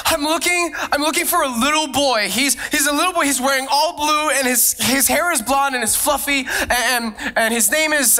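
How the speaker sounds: high, urgent voice of a frantic mom; panicked mother. Retold or imitated. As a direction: plead as the worried mother searching. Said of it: "And she said".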